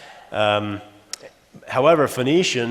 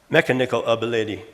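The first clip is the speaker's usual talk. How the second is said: quick "Mechanical ability", stressing first and third syllables; misplaced stress; faster than usual. Shown as a demonstration. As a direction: say "Mechanical ability" quickly as MEK-a-NIK-al AB-a-LID-y. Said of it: "put on the first and third instead".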